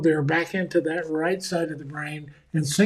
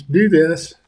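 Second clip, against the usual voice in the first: loud, blunt order; curt command; 7 dB louder than usual. Retold or imitated. Boss's note to staff, a blunt loud command. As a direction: bark it like a terse boss.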